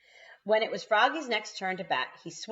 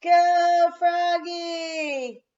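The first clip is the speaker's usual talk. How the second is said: much louder, higher cheering holler; hollering mom; 12 semitones higher, 10 dB louder, much slower than usual. A narrator's reading. Mom hollering from the stands for Froggy. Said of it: "hollered Mom".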